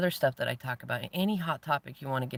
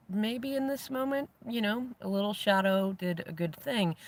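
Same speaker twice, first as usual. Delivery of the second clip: amused high voice; 8 semitones higher than usual. Retold or imitated. Amused inner thought.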